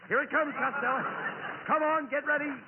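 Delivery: rally him before the wave; urgent call. Acted. Warning of a big wave.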